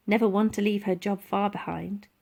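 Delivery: usual speaking voice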